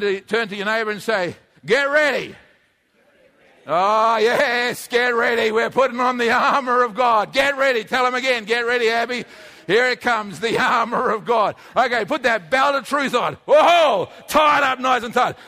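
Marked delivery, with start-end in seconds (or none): 1.6-13.3 rousing preaching. Acted. Rousing the congregation to armour up. 13.5-14.1 whoa exclamation. Acted. Exclaims while tying belt. 14.3-15.4 rousing preaching. Acted. Rousing the congregation to armour up.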